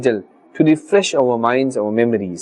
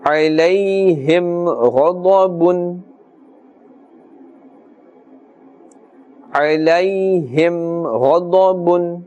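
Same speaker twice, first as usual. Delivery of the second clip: slow, sustained tajweed chant on a level tone; Quran recitation; slower than usual. Shown as a demonstration. Teacher recites the verse for learners, slow sustained chant. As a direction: chant slowly, holding the nasal hum long.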